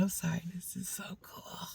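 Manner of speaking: hushed, whispered retold aside; whispered apology.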